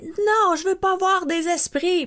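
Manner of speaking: high, panicked refusal; a frightened girl refusing to see spirits. Retold or imitated. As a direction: cry it out in retold panic, pitch jumping up. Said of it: "je prends panique, je fais".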